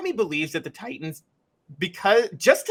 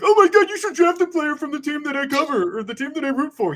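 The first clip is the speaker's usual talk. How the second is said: much higher mocking voice of a clueless fan; mock a homer fan pitched way up; dumb fan voice; 12 semitones higher than usual. Host mocking fans who want local college players drafted, in a much higher dumb-fan voice. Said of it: "That's a dumb fan voice".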